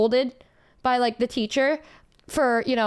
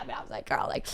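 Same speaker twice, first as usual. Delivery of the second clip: hushed aside; 13 semitones lower, 10 dB quieter, faster than usual. Retold or imitated. Retold aside to friend, hushed.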